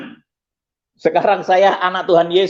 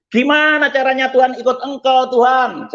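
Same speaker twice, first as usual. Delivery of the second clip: desperate plea; 5 semitones higher than usual. Retold or imitated. Convert begging God to show the way, high and pleading.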